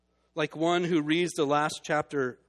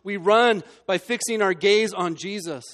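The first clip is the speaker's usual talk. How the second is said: louder, higher, hammering each phrase; emphatic preaching; 5 semitones higher, 10 dB louder than usual. Acted. Preacher urging listeners to fix their gaze, emphatic preaching. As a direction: drive home where to look.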